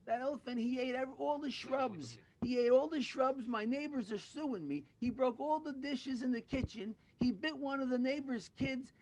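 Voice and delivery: high, loud, worked-up complaining; hammed-up frantic tirade; exasperated rant. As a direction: pile up grievances in mock outrage. Buyer rants about the awful elephant.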